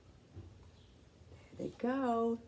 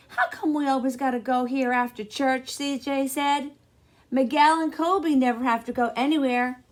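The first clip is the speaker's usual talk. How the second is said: put-on fast, louder kid's complaint; whiny little boy; 6 semitones higher, 8 dB louder than usual. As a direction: grumble like a boy sick of errands.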